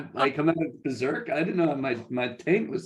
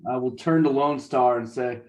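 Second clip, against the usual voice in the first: ordinary talk, nothing marked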